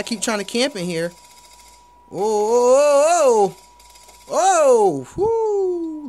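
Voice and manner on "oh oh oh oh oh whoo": loud startled oh-oh-oh; excited yelling. Real, not acted. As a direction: shout it out as the kill lands. Surprise kill during a firefight.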